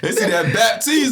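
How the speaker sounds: mock excited call. Mock reaction to Haitian name.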